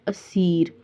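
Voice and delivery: असीर stopped on the r; long ee then plain r in असीर; simple stop ending. Shown as a demonstration. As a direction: say असीर without any "un". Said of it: "आपने पढ़ना है".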